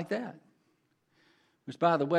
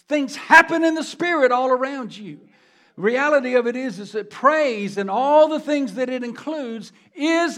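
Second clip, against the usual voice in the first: louder, higher preaching; building sermon intensity; 6 semitones higher, 6 dB louder than usual. Acted. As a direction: drive the point home to the church. Preaching at full force.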